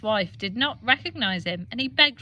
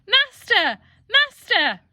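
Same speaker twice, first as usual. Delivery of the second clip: much higher, louder cry; calling out; 11 semitones higher, 7 dB louder, slower than usual. Acted. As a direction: call out for help, high. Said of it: "the harp called out".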